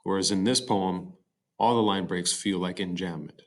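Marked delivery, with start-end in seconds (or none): none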